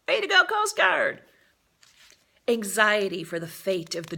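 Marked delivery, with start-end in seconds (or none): 0.1-1.2 high, loud excited cheer; cheering shout. Acted. Narrator cheering the Coast Guard as the rocket cart arrives, excited shout. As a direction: cheer the rescuers on from the crowd.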